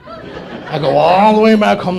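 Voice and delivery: very long, loud "all"; milk the word "all" for laughs; drawn-out ALL. Retold or imitated. Exasperated by a holiday trip, hugely drawn-out "all".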